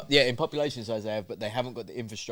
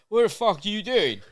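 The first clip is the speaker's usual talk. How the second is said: higher, louder startled outburst; startled shout; 8 semitones higher, 7 dB louder than usual. Retold or imitated. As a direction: shout it like you just woke up. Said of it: "and she said".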